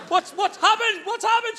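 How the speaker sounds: high-pitched panicky squeak, acted; panicking. Trapped dad calling to wife from toilet, mock panic. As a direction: ask like a startled little meerkat. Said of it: "I'm sitting there panicking like".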